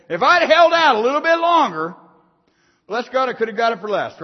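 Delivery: loud, high mock self-reproach; preacher voicing a buyer's regret, loud and high; regretful inner thought.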